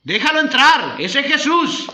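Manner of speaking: loud, high call across the room; mock shout to the far end of the room; shouted from afar. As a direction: yell it from the back of the hall. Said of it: "le gritó de la otra punta allá".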